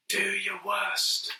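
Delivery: slow, soft, hushed aside to his pal; whispered. A narrator's reading. Whispering defiance to his pal. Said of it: "he whispered to his pal".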